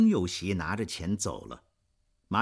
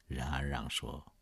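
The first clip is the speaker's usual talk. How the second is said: usual speaking voice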